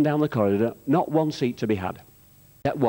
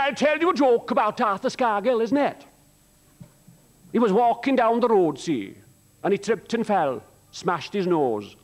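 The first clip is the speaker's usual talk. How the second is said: sing-song Welsh lilt, pitched higher; Welsh accent; 6 semitones higher than usual. Retold or imitated. Comedian retelling a Welsh chap's joke, Welsh accent. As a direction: tell it like a chatty man from the Welsh valleys, Welsh accent. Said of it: "I've got to do this in a Welsh accent".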